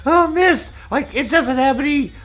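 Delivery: very high-pitched complaining customer; hammed-up high, panicky whine; mock freaking out. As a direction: imitate a fussy customer calling the waitress. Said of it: "freaking out, going".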